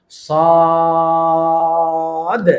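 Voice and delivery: "সদ" with a thick s and long level vowel, bouncing off the d; very slow emphatic Arabic "সদ"; heavy, stretched letter. Shown as a demonstration. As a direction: hold "সদ" long and full, then release the final d. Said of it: "মোটা করব এবং শেষে একটা ধাক্কা দিব".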